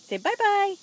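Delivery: loud sing-song bye-bye; high cheery prompt. Real, not acted. Parent urging baby to say bye, bright and high.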